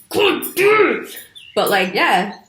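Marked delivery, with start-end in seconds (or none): 0.1-1.2 much higher, slow exasperated curse; mock exasperated curse. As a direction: wail it like you just banged your elbow. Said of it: "I was just like".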